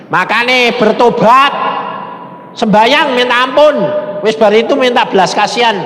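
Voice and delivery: loud, high-pitched exhortation; teguran bertobat. Retold or imitated. Retold stern scolding to repent. (menegur dengan suara lantang)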